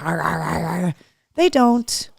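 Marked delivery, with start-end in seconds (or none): 0.0-1.0 low, softer mock growls; quiet play-growl noises; imitated dog growling. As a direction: imitate wrestling pugs, low and repeated. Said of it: "cause there was, like".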